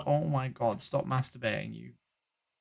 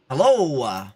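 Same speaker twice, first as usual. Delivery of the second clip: ordinary talk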